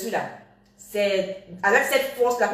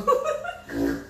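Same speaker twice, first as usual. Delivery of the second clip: drawn-out laugh after own joke. Real, not acted. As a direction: burst out laughing, then let it trail. Laughs at own joke about payment.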